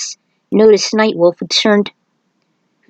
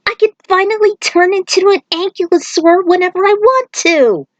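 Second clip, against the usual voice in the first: high, eager boast; bursting with glee; excited Aiko; 11 semitones higher than usual. Acted. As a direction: Aiko boasts she can transform at will, high. Aiko gloats about transforming.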